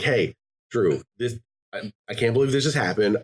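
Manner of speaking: ordinary talk, nothing marked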